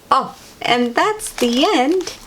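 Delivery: ordinary delivery, nothing marked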